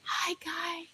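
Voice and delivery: high, soft, breathless voice; haunted, out-of-breath greeting. Acted. Streamer mocks how she is expected to speak, soft breathless voice. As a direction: whisper a thin ghostly welcome as if winded. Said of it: "I sound like I'm out of breath".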